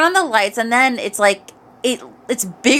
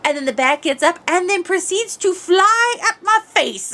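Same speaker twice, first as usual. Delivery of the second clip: ordinary delivery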